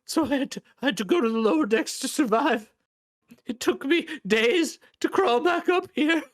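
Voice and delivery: high, loud whiny plea; pleading crippled man. Acted. Crippled man accusing Lorne of broken promises, high pleading. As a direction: beg him in a high, strained voice.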